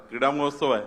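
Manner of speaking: normal talking voice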